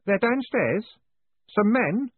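put-on high, nervous young voice; high-pitched, hesitant lad; uncertain boy. A narrator's reading. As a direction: report it timidly, not sure what else to say. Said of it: "grinning uncertainly".

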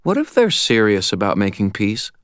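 a hint of a nervous young woman; timid apprentice voice. A narrator's reading. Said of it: "Molly said".

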